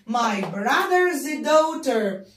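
my brother's daughter very slowly; slow model phrase. Shown as a demonstration. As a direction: stretch each word for pupils to repeat. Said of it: "بنت أخويا".

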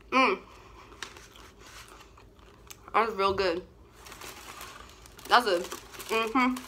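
chewing while talking; talking through food; mouth full. Real, not acted. Seen 2: Bites at -1.0, cheeks full and chewing through 7.1.

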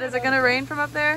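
loud, high call upward. Real, not acted. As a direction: shout up to someone above. Calling up to dad.

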